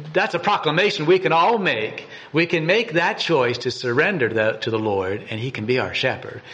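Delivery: ordinary talk, nothing marked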